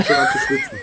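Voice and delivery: high giggly real laughter; laughing. Real, not acted. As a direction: laugh the words out. Giggling while admitting tipsiness.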